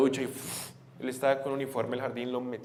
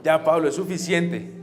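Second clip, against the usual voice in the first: loud, raised-pitch fatherly telling-off; stern retold scolding; 4 semitones higher, 9 dB louder than usual. Father to tantrum-throwing son, loud and firm. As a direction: put your foot down as dad.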